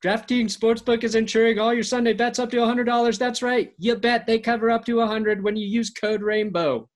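upbeat sponsor read. Acted. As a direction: push the promo code. Ad read resumes with promo code.

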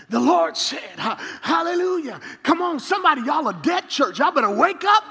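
high, shouted preaching; child playing preacher. A boy preaching to stuffed animals, high and shouted. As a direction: holler at the dolls like a fiery preacher. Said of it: "I'd just start preaching to 'em".

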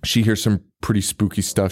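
ordinary talk

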